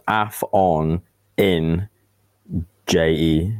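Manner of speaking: AF ON IN J E broken slowly into separate pieces; pronounced as written. Shown as a demonstration. Showing co-hosts how the name is written, AF ON IN J E spelled out slowly. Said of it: "pronounce it as it's written".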